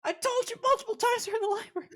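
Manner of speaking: shrill, fast exasperated yell; exasperated shout. Real, not acted. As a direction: yell it, fed up repeating. Player exasperated, repeating himself.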